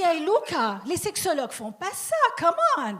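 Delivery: lightly acted Italian friend protesting. Mario scolds his friend Luca.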